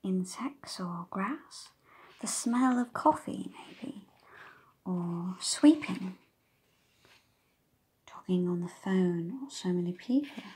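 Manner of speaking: put-on hushed sound list for a child; whispered listing. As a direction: breathe the words as a gentle whisper.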